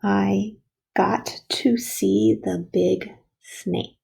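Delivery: slow, word-by-word dictation. Shown as a demonstration. Teacher gives the next sentence to write, slowly dictating. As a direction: spread the words out for young writers. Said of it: "it's going to say".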